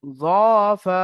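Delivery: জ and ফা each drawn out long, জ with rounded lips; one-alif stretch. Shown as a demonstration. Teacher models letters for students to read along, one-alif stretch. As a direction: hold জ, then ফা, one beat of stretch each. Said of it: "জ ফা এক আলিফ করে টান".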